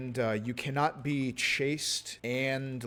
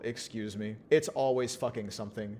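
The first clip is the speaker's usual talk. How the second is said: usual speaking voice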